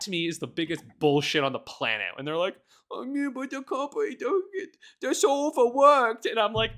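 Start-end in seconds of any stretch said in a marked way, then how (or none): 2.9-6.3 high put-on voice of defenders; mocking whiny voice. Imitating people excusing the company, high mocking voice. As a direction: parody the excuse, higher and sillier.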